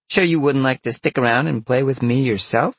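high, loud flirtatious vixen; teasing vixen. A narrator's reading. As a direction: tease him in a high, coaxing voice. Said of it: "Vixen Peg teased".

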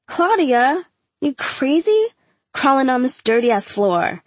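high, incredulous teasing; half-laughing at her friend; amused disbelief. A narrator's reading.

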